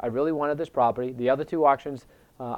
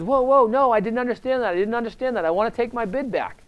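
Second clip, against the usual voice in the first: higher, hurried alarm; panicked protest; 9 semitones higher, 3 dB louder than usual. Retold or imitated. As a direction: backpedal in a panic. Panicked bidder retracting bid.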